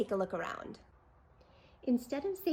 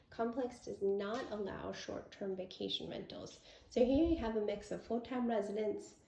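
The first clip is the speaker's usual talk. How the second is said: normal talking voice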